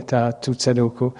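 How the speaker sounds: usual speaking voice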